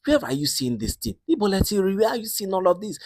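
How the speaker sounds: high-pitched disbelieving questions; shocked mother. Retold or imitated. Mother questions Latifa about the groceries, shocked. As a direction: play a mother taken aback, voice jumping up. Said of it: "Her mother was shocked".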